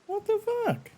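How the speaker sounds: very high squeaky disbelief; outraged squeak. Real, not acted. Streamer finds her lemongrass dead, squeaky outrage. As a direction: shriek it in tiny outraged disbelief.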